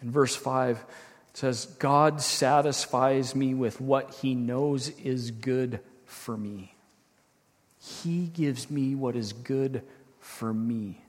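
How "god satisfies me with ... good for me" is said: slow, measured scripture reading, growing softer; unhurried, ending low and soft. Real, not acted. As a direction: take your time and let it sink quietly. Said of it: "I'll read them through slowly".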